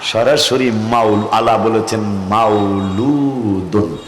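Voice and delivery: low, very slow and drawn out; stretched, weighty delivery; deliberate emphasis. Real, not acted. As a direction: linger on each syllable in a low voice. Preacher lingers on the proof word.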